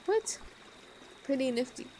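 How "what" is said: sudden squeaky high what; jolted disbelief; startled by an odd power; surprised. Real, not acted.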